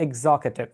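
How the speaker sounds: Executive said as "eg-ZOK-e-tiv", falling; correct pronunciation. Shown as a demonstration. Teacher repeating for the class, Executive as "eg-ZOK-e-tiv". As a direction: model Executive with stressed ZOK. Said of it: "The correct way to pronounce this word".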